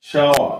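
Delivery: "শাওয়া" ending on an open a, no r; loud, falling "শাওয়া" with silent r; dropped final r. Shown as a demonstration. Teacher modelling shower without its r, "শাওয়া" ending open. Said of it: "লোপ পেয়ে যাবে যার মানে".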